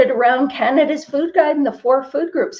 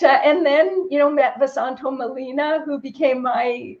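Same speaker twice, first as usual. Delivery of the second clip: normal talking voice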